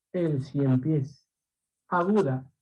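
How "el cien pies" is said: El cien pies, very slow and low, stressed on pies. Shown as a demonstration. Teacher letting pupils hear the accent of El cien pies, slow stress on pies. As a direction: draw out El cien pies so the final pies carries the accent. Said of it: "¿Qué tipo de palabra es por el acento?".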